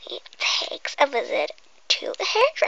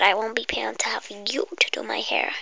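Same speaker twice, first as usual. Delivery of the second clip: whisper; 4 semitones lower than usual. Acted. Whispering to the viewer before the pretend hairdresser game. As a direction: breathe every word like an ASMR intro.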